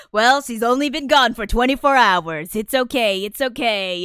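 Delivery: loud, lightly voiced reassuring cop; hammed-up officer calming a worried family; mock police officer. Streamer imitating police on a missing files case, mock reassuring. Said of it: "it's like a missing persons case".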